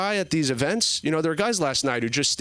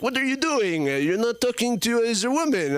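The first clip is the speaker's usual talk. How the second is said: creepy French organizer; 6 semitones higher than usual. Retold or imitated. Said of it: "He's like".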